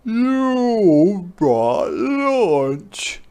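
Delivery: very slow, high bleating goat voice; bleating goat delight. Acted. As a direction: drag it out with greedy glee.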